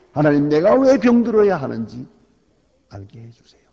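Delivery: anguished prayer shout; loud plea. Acted. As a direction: call out to God in a sick man's plea. Acted pleading prayer when sick.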